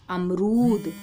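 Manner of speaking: अमरूद with the ऊ held long and punched; teacher stretching the ऊ in अमरूद for the class; stressed long ऊ. Shown as a demonstration. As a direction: lean hard on the long ऊ in अमरूद. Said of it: "अब ऊ की मात्रा हम जोर देकर बोल रहे हैं".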